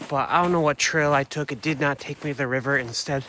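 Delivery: usual speaking voice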